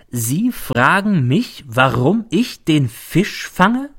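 SIE FRAGEN MICH, each word slow and separately stressed; stressing every word. Acted. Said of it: "als betonte er jedes Wort". (langsam, jedes Wort von „Sie“ bis „fange“ betont)

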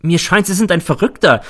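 high, loud and flustered refusal; frightened woman backing away; Nelly alarmed. A narrator's reading. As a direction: shut the conversation down fast.

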